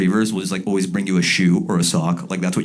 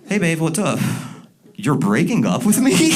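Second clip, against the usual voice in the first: fake phone call; 8 semitones higher than usual. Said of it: "And you go".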